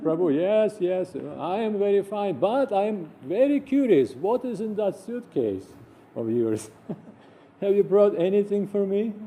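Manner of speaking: teasing his disciple about the suitcase, high and curious; curious guru. Retold or imitated. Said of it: "He said".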